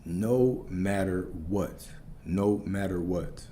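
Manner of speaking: "No matter what" repeated slowly, word by word; slow, measured weight on every word of "No matter what"; heavy emphasis. Real, not acted. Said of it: "I cannot emphasize this enough".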